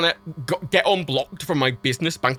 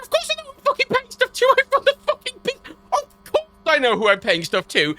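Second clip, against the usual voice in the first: furious retort; 6 semitones higher than usual. Retold or imitated. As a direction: bite back at the condescending banker. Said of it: "I was like".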